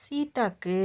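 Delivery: plain reading voice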